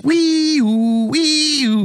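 high, sustained two-note siren wail; imitated police siren. Teasing UK police to co-hosts, imitated siren. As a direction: wail like a British police car. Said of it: "they have the weird police".

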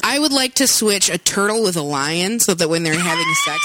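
usual speaking voice

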